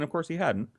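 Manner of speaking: usual speaking voice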